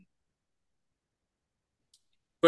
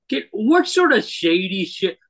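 mock outrage; 6 semitones higher, 4 dB louder than usual. Real, not acted. Mock outrage at bread news.

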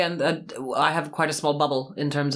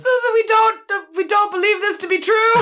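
much higher and louder, half shouting; a loud, flustered denial; laughing emphatic disclaimer; 5 dB louder than usual. Real, not acted. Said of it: "Just to clarify".

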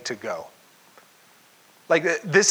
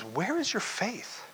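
soft rebuke; 4 semitones higher, 8 dB quieter, slower than usual. Retold or imitated. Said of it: "he says".

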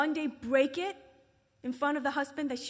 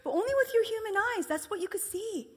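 teasing jab; 7 semitones higher, 4 dB louder than usual. Retold or imitated.

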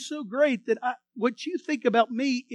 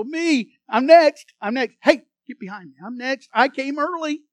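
raised, pushy voices jostling for a place in line; eager crowd calling out; 3 semitones higher, 9 dB louder than usual. Retold or imitated. People shoving in line, loudly claiming their turn. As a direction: call out over the crowd to be next.

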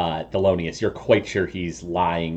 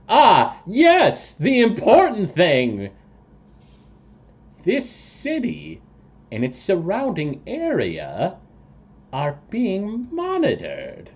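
high voice, slow and measured; portentous toad creature; 10 semitones higher than usual. Acted. Toad person reveals his news to party, high and slow. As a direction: announce it with slow, high self-importance.

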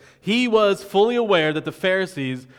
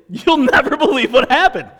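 higher and louder, laughing through the news; excited disbelief; 9 semitones higher, 8 dB louder than usual. Acted. Healed man telling a friend his news, excited and laughing. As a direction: burst out with amazing news, half laughing.

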